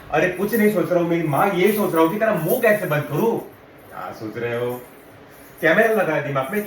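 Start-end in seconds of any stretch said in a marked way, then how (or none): 0.1-3.4 loud, fed-up snap; acted burst of irritation, loud and unbroken; exasperated outburst. Boyfriend snaps at repeated question. 3.4-4.8 low, slow, soft mock nagging; mock girlfriend, dropped low and slow; mimicked nagging question.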